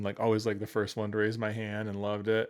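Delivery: ordinary talk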